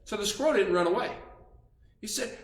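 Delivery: usual speaking voice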